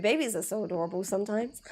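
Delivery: normal talking voice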